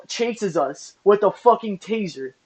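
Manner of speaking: ordinary delivery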